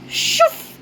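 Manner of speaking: short airy "shoof"; whooshing sound effect. A narrator's reading. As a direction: imitate a sudden rush of air. Imitated door effect in story.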